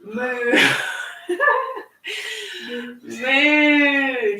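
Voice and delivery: playful cow moo with a laugh. Shown as a demonstration. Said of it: "you can go moo".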